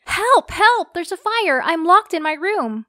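very high, loud desperate yells; screaming for help. Acted. Locked-in girl screaming to the neighbors outside for help. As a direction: scream out the window with all the energy left. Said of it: "I started screaming at them".

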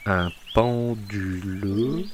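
"Un pendule" drawn out very slowly. Shown as a demonstration. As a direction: dictate "Un pendule" slowly, word by word. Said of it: "Attends, attends, attends, moins vite".

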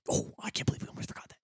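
fast, excited whisper; hurried whisper, keeping it secret. Acted. Player voicing Jacques, rushed whisper. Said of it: "And Jacques is like".

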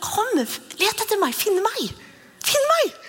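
high, slow, playful call to come and find; God voiced as a high playful hide-and-seek call. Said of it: "Det er jo bibelvers på det".

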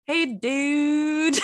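high, drawn-out try at British; badly faked British accent, pitched high; attempted British accent. Shown as a demonstration. Working out a British greeting with a friend, high faked accent. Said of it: "in a British accent?".

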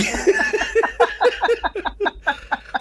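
high laugh burst. Real, not acted. Laughing at himself.

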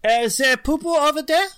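high, loud put-on foreign voice; mock foreign phrase. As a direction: lightly mimic a foreign speaker asking a silly question. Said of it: "it sounds like".

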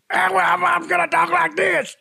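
high Dude impression; Jeff Bridges impression. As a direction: squeak it out like the Dude. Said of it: "Jeff Bridges".

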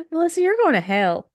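laughing high and loud. Real, not acted. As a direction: crack up while scolding. Laughing at friend's joke.

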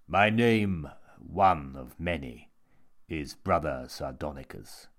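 grand introduction, dropping low; theatrical sinister monk. A narrator's reading. Monk introduces himself to Jimmy, grand and ominous.

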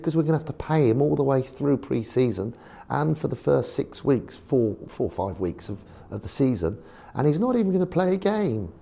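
put-on posh accent, rising to a high complaint; Eddie Rogers. Chairman objects to paying a banned player, mock posh complaint. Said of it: "'cause very posh, Eddie".

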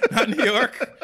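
laughing, slow and loud. Real, not acted. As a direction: laugh it out while echoing. Echoing the joke.

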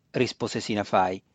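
ordinary delivery, nothing marked

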